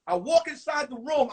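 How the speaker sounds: ordinary delivery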